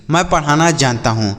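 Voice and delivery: usual speaking voice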